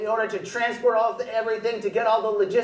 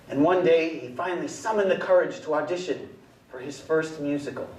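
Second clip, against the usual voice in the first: podium speech; 10 semitones lower, faster than usual. Acted. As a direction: speak like a pompous awards presenter. Presenter in a grand podium persona.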